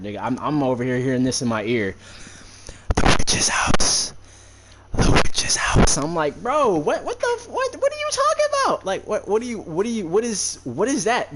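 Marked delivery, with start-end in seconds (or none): 2.9-6.0 slow, loud rasping ghostly whisper; raspy ghostly whisper. Retold or imitated. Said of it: "this female, raspy, ghostly voice, and it's just whispering". 6.4-11.3 very high, frantic, confused questions; panicked disbelief. Retold or imitated. Panicked reply to the ghost.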